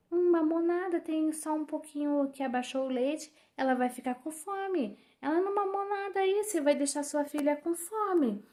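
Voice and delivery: high, shrill-pitched mock scold; lightly hammed nurse scolding a new mother. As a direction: mimic the bossy nurse, pitched well up.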